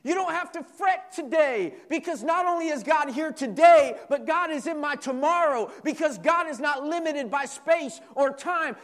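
high, loud, rising sermon cadence; a pastor building to a loud, high call over the church; preaching at full force. Acted. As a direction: preach it to the congregation with fire.

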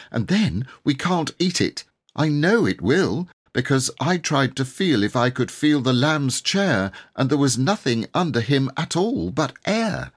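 put-on a hungry small girl worrying aloud; fretful little girl. A narrator's reading. Jane fretting to the others about dinner, high and anxious.

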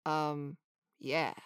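low, understated little introduction; laid-back sandpiper. A narrator's reading. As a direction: introduce yourself coolly and evenly. Sandpiper answers coolly.

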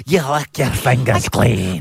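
slow mocking pirate impression. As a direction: mimic her pirate voice. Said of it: "talk like a pirate".

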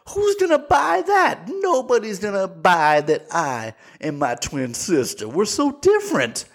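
high, drawn-out mock disbelief; incredulous protest. Mimicking the film's twin sister refusing the plan, hammed-up incredulity. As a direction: play her as wildly scandalized, pitch shooting up.

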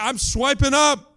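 hooked and thrilled to swipe up; eager. Retold or imitated. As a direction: sell the excitement of swiping up. Woman's retold line, eager retake.

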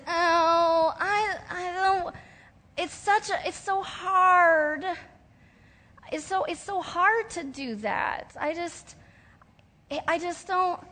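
high, teary, halting reluctant whine. Retold or imitated. Believer balking at giving to Jesus, teary high whine. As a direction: whimper it like a reluctant child dragging feet. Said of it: "doesn't want us to be reluctant".

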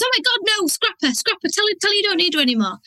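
voice of fear. Retold or imitated. [name] voicing her fear about paying the VA, high and panicky. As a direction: squeak out the panic, then rush it. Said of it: "this voice of fear goes".

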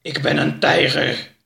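growled proclamation from a tiger's maw; growling tiger house. A narrator's reading. House declaring itself a tiger, growling. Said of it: "gromde de muil".

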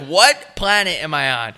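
mildly outraged disbelief; mad. Retold or imitated. Podcaster recalling his disbelief while waiting in line, mad. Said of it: "Now I'm really mad".

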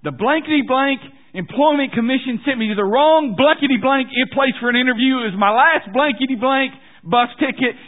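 high, full-voiced ranting with censored swearing; loud phone rant. Stranded diner ranting into his cell phone, loud and high. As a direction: shout it, complaining nonstop at high pitch.

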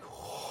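soft, breathy whew; quiet blown-out sigh; exhaled whew. Retold or imitated. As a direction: let out a hushed breath, as if overwhelmed. Retold breath of being overwhelmed.

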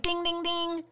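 high, even, softly chimed dings; imitated alarm bell. Podcaster voicing her inner alarm at breaking a rule, high bell imitation. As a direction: chime it like a warning bell going off. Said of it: "I was like".

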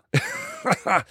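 a sudden high burst of laughter; high chuckle. Retold or imitated. Stuntman laughing about meeting another stuntman in a hospital. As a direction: laugh it off, amused. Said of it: "he starts to chuckle".

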